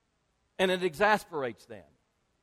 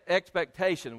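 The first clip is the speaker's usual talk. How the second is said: ordinary talk, nothing marked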